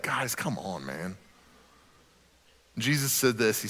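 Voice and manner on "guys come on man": exasperated. Retold or imitated. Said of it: "he's like".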